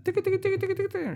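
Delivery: very high clicking keyboard sounds; typing noise. Retold or imitated. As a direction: tap out the typing with your voice. Imitating officer typing on keyboard.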